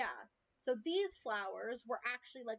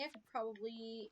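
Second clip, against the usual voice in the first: ordinary talk, nothing marked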